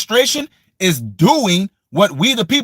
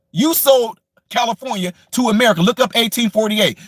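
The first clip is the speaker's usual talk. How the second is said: ordinary delivery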